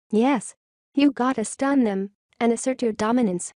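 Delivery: ordinary talk, nothing marked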